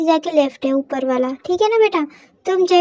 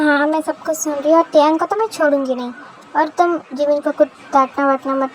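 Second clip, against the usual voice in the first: ordinary talk, nothing marked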